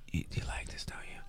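breathy sultry whisper. Retold or imitated. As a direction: breathe it softly, seductive. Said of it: "she says".

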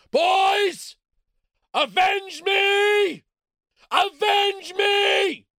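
dying father's desperate howl; overacted death scene. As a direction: bellow it slow, high and loud. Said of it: "the dad in Red Dawn".

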